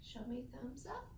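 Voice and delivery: soft classroom whisper. Real, not acted. Teacher whispers instruction to class.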